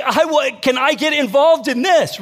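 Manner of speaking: high, loud, excited plea; eager neighbor. Retold or imitated. Neighbor asking to join the group, excited. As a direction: imitate an onlooker keen to join in.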